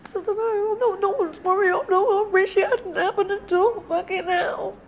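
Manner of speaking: on the edge of tears, real; tearful. Real, not acted. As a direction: voice breaking high and upset. Breaks down, friend comforts her.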